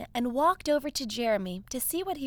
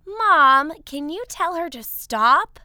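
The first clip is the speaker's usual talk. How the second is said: whiny call; 4 semitones higher, 8 dB louder than usual. Acted. Sister annoyed, calls to Mom.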